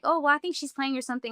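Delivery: ordinary talk, nothing marked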